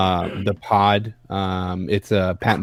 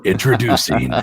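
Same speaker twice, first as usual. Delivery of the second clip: movie trailer voice. Shown as a demonstration. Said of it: "I can't do that".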